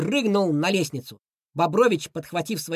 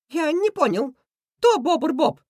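high-voiced villain, baffled; high, perplexed demand; confused Krysion; 9 semitones higher, 4 dB louder than usual. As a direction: ask in high cartoon bewilderment. Confused villain asks who is real.

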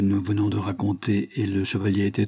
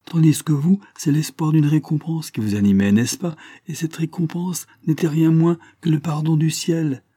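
higher, louder, eager insistence; hasty interruption; 8 semitones higher, 4 dB louder than usual. A narrator's reading. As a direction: cut in fervently to finish his thought. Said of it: "interrompant le malade, se hâta d'ajouter".

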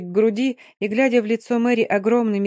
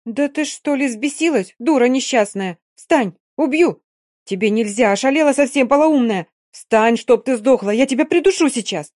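furious shout at a friend, louder and higher; indignant shouting; 4 semitones higher, 4 dB louder than usual. Acted. Dina screaming at Yulka to get off the floor, furious shout. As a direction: scream at her to get up or else. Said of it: "возмущённо кричит Дина".

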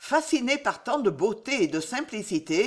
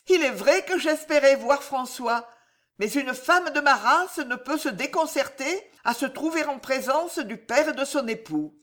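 voix tremblante; 6 semitones higher than usual. Acted. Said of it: "d'une voix tremblante".

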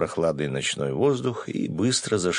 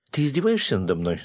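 high, mildly shouted question; indignant cry; 6 semitones higher than usual. A narrator's reading. Girl realising he is mocking her, mild indignant cry. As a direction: protest it with understated outrage, pitched up. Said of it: "закричала она".

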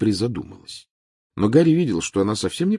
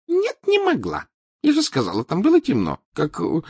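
Trelawney voice; 5 dB louder than usual. Acted.

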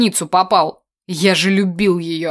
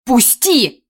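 higher, sharp cry to break free; urgent raised-voice demand; desperate cry; 5 semitones higher, 4 dB louder than usual. A narrator's reading. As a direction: cry out while struggling. Tamara struggles in his grip.

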